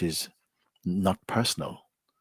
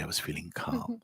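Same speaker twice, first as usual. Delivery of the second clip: hushed whisper; 2 semitones lower, 10 dB quieter than usual. Retold or imitated. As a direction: whisper it, barely voiced. Quoting a calm feeling.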